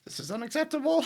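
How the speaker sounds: high, squeezed mock indignation; mock outrage. Player objecting as Flappy the bird, mock outrage.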